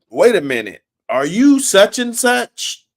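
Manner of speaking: imitating a man catching on to who he's talking to; mock surprise. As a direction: act like you just placed the name. Retold guy recognizing a rival.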